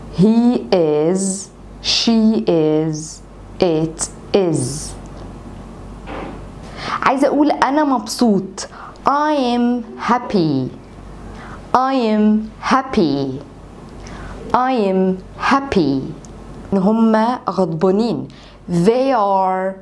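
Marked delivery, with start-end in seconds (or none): 0.1-4.9 slow drill. Shown as a demonstration. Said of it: "احفظ الجملة زي ما أنا بقولها لك كده". 9.1-16.0 very slow, stretched repetitions; slow drill. Shown as a demonstration. Teacher drilling a sentence for students, very slow. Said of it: "احفظ الجملة زي ما أنا بقولها لك كده".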